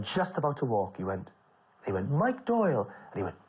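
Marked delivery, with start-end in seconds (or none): 2.1-2.9 bright, high call of the name; announcing. Retold or imitated. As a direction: announce him with a flourish. Les announcing him.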